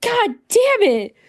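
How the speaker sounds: high, slow, drawn-out exasperation; exasperated. Retold or imitated. Speaker reacting to Scar's answer, high slow exasperation. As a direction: groan it out in mock despair. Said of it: "And I'm like".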